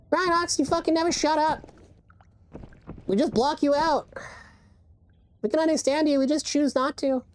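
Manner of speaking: high-pitched teasing put-on voice; playful high cartoonish heckle; mock squeaky voice. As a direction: tease a friend in a squeaky falsetto. Mocking reply in put-on voice.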